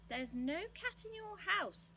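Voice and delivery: high, drawn-out exasperated call; shouting. A narrator's reading. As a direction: raise the pitch and stretch it out. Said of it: "shouted Moonface".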